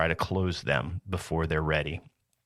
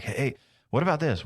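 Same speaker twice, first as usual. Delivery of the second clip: higher, eager burst of an idea; excited client; 8 semitones higher than usual. Retold or imitated. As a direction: imitate a scattered client blurting out ideas. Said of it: "They're like".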